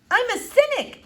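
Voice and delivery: blurted too loud. A narrator's reading. Flora blurting to the doctor, too loud. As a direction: announce it far too loudly. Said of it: "in a too loud voice".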